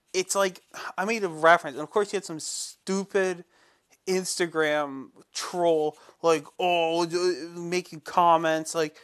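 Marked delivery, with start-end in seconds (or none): none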